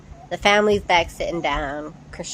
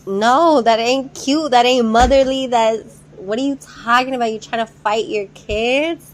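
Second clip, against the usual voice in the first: higher, louder indignant disbelief; outraged; 4 semitones higher, 5 dB louder than usual. Real, not acted. Vlogger scolding the mother who wants to fight, indignant. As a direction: call out the mother, fed up.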